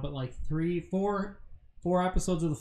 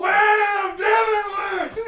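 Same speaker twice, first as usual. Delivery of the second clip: high, loud triumphant shout; excited yell; 8 dB louder, much slower than usual. Real, not acted. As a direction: whoop it out after a dab move. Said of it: "What did you yell when you did that?".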